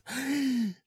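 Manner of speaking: high, drawn indrawn breath; dramatic gasp. Shown as a demonstration. Host relishing a hot breakfast, gasping. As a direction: suck in air sharply.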